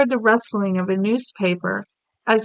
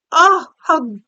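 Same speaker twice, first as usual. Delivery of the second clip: slow, louder anguished cry; cried out; 4 semitones higher, 7 dB louder, slower than usual. Acted. The Queen crying out in mild dismay at the news. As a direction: let the horror break out slowly. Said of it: "the voice seemed cried out".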